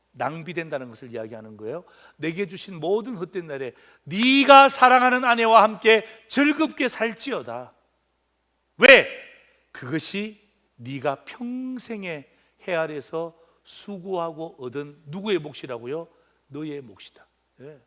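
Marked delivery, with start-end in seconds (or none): 2.2-7.7 louder, higher, stressing 네가; emphatic scripture reading. Acted. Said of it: "preacher rereads verse with emphasis". 9.7-15.0 slow scripture reading. Acted. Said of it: "slow scripture reading".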